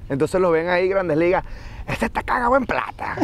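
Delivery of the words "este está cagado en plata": high-pitched imitation of impressed onlookers; mock exclaiming onlooker. Onlookers seeing a Grandes Ligas player, mock exclaiming. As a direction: exclaim it high, playfully quoting what people say. Voicing onlookers gossiping about big leaguers.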